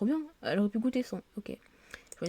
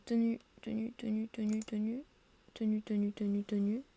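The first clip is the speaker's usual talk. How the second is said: absent-minded muttering; 3 semitones higher than usual. Real, not acted. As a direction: murmur it to yourself while searching. Hunting through outfits, talking to self.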